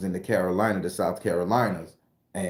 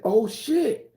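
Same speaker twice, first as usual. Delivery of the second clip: high, startled exclamation; shocked oh shit; 13 semitones higher than usual. Retold or imitated. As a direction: blurt it out in shock. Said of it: "and I said".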